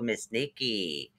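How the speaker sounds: ordinary talk, nothing marked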